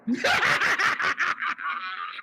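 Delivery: high, soft cackle; amused cackle; high laugh. Real, not acted. Laughing at rivals.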